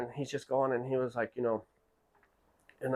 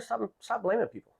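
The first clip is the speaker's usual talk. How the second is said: high, sharp scolding boss voice; angry boss; 11 semitones higher than usual. Retold or imitated. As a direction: cut them off like a fed-up boss.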